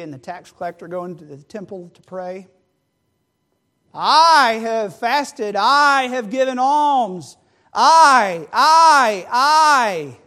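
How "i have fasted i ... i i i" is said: slow, loud, drawn-out bragging; self-righteous boast. Acted. Pharisee praying in the temple, slow loud boasting. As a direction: lean on every I like a proud Pharisee.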